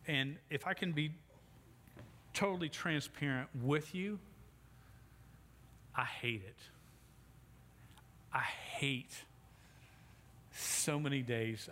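normal talking voice

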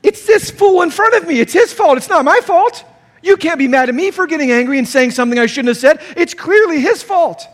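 loud, high, indignant blaming; heated excuse to wife in traffic, shouting; angry driver rant. Retold or imitated. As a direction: rant at the other driver, furious. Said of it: "What do you do?".

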